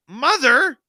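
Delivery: high, loud mock exasperation; exasperated protest. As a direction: scold mom in playful disbelief. Kids protesting to mom in story.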